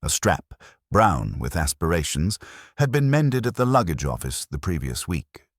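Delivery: usual speaking voice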